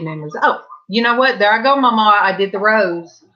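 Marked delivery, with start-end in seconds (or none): none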